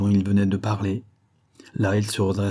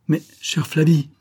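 higher, louder protest; anguished outcry of a tormented lover; cried out; 8 semitones higher, 5 dB louder than usual. A narrator's reading. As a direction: cry out her name in dismay. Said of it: "s'écria Albert Morel".

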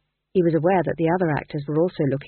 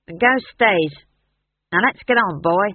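firm; 5 dB louder than usual. A narrator's reading. Howell asserts authority.

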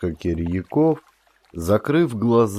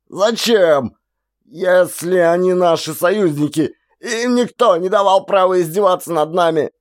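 much higher, loud furious yell; outraged yell; 9 semitones higher, 4 dB louder than usual. A narrator's reading. Outraged soldier screams about the allies, furious yell. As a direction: hurl it out in outrage.

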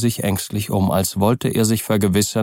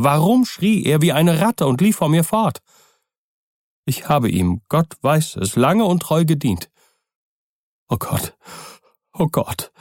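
put-on frightened old servant; 8 semitones higher than usual. A narrator's reading. Poole, frightened.

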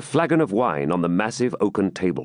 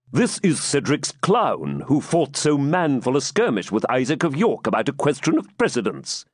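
lightly raised voice of a scornful knight; amused knight; 6 semitones higher than usual. Acted. De Bracy explaining the clown to the baron, light scorn. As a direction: explain with a knowing sneer.

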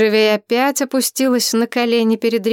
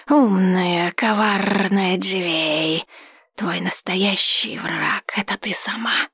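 whispering dragon; slower than usual. Acted. Dragon keeps taunting her.

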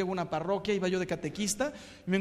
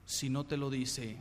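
softer, slower repeat of "Si no te lo dice"; quiet slow echo; 4 semitones lower, 6 dB quieter than usual. Shown as a demonstration. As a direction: let "Si no te lo dice" sink in, softly and slowly.

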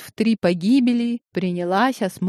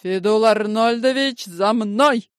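commanding bark; 3 semitones higher, 3 dB louder than usual. A narrator's reading. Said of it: "командирским голосом рявкнула Зинаида".

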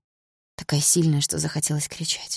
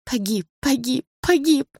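slow repeats, climbing higher each time; echoing in her head; 8 semitones higher, slower than usual. A narrator's reading. Death news echoing in the narrator's head, rising repeats. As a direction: let the words ring out, ever higher. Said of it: "эхом отдававшийся в голове".

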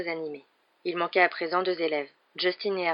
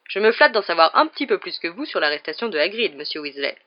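loud, self-satisfied put-down; smug; 6 dB louder than usual. A narrator's reading. Lockhart puts Ron down, loud and smug. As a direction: preen while correcting him. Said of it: "d'un ton satisfait".

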